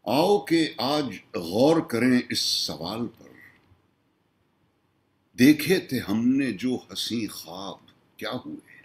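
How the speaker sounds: measured Urdu nazm recital, pitched above his talk; declaimed mushaira-style poetry; poem recitation. Acted. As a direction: recite it as verse, pausing after each line.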